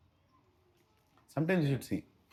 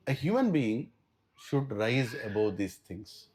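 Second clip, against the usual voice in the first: usual speaking voice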